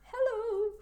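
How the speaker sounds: high, giggly English hello; a shy, high hello in English; laughing hello. Shown as a demonstration. Demo of greeting the host family with a laughing hello. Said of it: "você falaria um oi".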